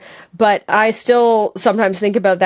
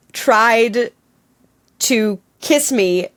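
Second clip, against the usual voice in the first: usual speaking voice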